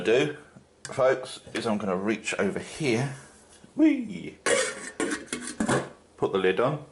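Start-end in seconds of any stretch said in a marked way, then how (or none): 3.7-4.4 playful squeal. Real, not acted. Reaching over for the lid.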